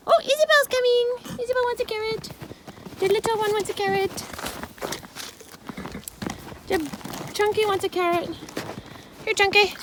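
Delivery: high sing-song pet voice. Real, not acted. Feeding ponies carrots.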